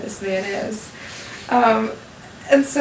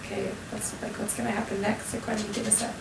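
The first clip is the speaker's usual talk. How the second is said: sleepy confused murmur; 12 dB quieter than usual. Retold or imitated. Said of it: "we're like".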